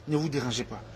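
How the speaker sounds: dropped almost to a murmur; soft aside. Real, not acted.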